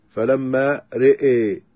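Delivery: slow فلما رئي with رئي as "re'ay"; drawn-out e-coloured رئي after فلما; imala. Shown as a demonstration. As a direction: tilt both vowels of رئي toward e. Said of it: "أمال الراء والهمزة والألف".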